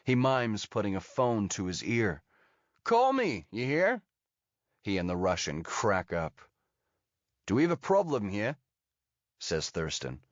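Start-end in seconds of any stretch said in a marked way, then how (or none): 2.8-4.0 high-pitched, taunting American call; American accent, pitched way up. A narrator's reading. 7.5-8.6 quick, higher-pitched challenge with some edge; understated edgy challenge, fast. A narrator's reading. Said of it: "There's an edge to his voice".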